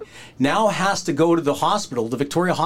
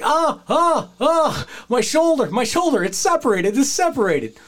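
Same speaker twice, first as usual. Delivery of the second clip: retold cry of pain; 12 semitones higher than usual. Wrestler moaning to the booker about his separated shoulder, high pained cry. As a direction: imitate a wrestler crying out over his shoulder. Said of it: "He's like".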